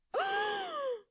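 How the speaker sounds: loud, high mock-offended gasp; theatrical high gasp; sharp gasp. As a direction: gasp as if scandalised. Mock shock at his reaction.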